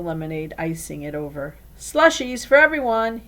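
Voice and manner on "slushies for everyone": high, loud grand announcement; proclaiming. A narrator's reading. Elliot announcing slushies to friends, loud and proud. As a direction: proclaim it to the whole party. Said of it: "he proclaimed".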